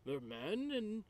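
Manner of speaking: soft, high, airy character voice; musing in a delicate put-on voice; hopeful peacemaker. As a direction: float the suggestion gently. Player voices own character.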